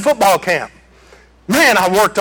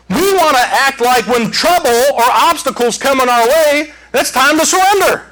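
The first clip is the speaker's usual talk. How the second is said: raised, driving pulpit voice; preaching at full force; 7 semitones higher than usual. Acted. Preacher to congregation, high driving preaching. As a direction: preach it up to the back row.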